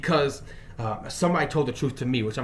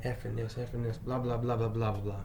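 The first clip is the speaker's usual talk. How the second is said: usual speaking voice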